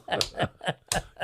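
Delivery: silent wheezing laugh; laughing breathlessly; airy wheeze of a laugh. Real, not acted. Laughing at own story.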